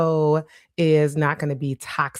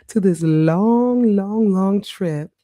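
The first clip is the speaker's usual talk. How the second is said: "long, long, long" stretched slowly; drawn-out emphasis; 4 semitones higher, 4 dB louder, slower than usual. Real, not acted. Describing the family trip, stretching out "long". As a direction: stretch each "long" to show the length.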